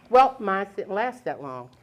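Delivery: normal talking voice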